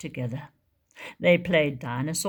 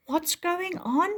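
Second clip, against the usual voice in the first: small worried voice; 11 semitones higher than usual. Acted. Tiny asks worried.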